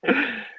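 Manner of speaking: short high laugh. Real, not acted. Host laughing at the phone-a-friend joke, high laugh. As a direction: let a laugh pop out.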